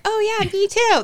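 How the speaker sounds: high-pitched imitation of gushing agreement; eager mock agreement. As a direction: play up the instant, delighted agreement.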